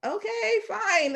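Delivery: put-on a child brushing off being refused, in a high voice; high childish okay fine. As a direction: toss it off like a hurt little kid.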